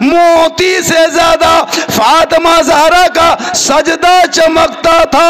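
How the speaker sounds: slow, high, loud sermon chant; impassioned preaching. Acted. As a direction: lift it into a fervent cry. Preacher extols Fatima's devotion. (धीमी, ऊँची, बुलंद जोशीली तक़रीर)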